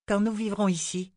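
put-on high-pitched child's questioning voice; high girlish voice, earnest question; little girl Rachel, curious. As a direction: ask it as an eager little girl, pitched well up.

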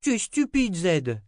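put-on very high child voice, tossed out; little girl retort. A narrator's reading. Little girl retorts to the old wizard, high-pitched. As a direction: throw it back as a cheeky little girl. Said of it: "lança Rachel".

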